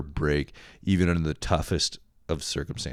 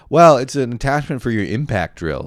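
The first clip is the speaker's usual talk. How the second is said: louder, higher mock announcement; gift-giver's pitch; 6 semitones higher, 9 dB louder than usual. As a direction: ham up the next Christmas present. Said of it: "I know I'm gonna get".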